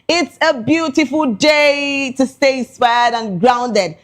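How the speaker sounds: ordinary talk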